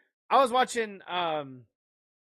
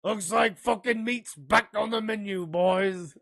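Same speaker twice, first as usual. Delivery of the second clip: higher, put-on rallying film voice; mock orc quote; 4 semitones higher, 4 dB louder than usual. Joker rallying the boys about meat, mock film voice. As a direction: announce it to the troops, hammed-up.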